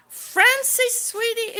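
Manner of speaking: high, loud, delighted call of a name; excited greeting. Real, not acted. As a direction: greet a friend who just arrived. Greeting a viewer in live chat.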